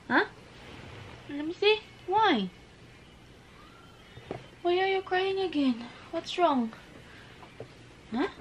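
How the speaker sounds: high, soft-hearted parent coo; high-pitched soothing questions. Real, not acted. Parent comforting a crying child.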